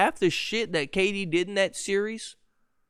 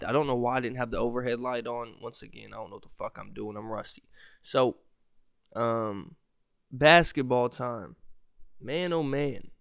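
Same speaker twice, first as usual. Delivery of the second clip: normal talking voice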